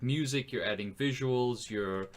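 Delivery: usual speaking voice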